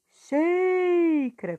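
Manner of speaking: XÍ in "xícara" stretched long, high and loud; drawn-out, punched XÍ, quick light ending on "xícara"; stressed syllable. Shown as a demonstration. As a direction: call out "xícara" leaning hard on the first syllable. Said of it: "que você pronuncia com mais força".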